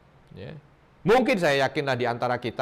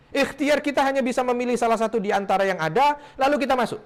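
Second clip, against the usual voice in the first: high, loud sermon delivery; fiery preaching; 10 semitones higher, 4 dB louder than usual. Acted. Preacher rouses the congregation about the cave, fervently. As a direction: preach it with rising fervour.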